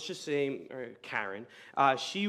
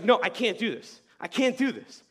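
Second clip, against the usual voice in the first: higher-pitched mock panic; imitated freak-out; 5 semitones higher, 5 dB louder than usual. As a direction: act out a panicked refusal. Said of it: "she starts freaking out".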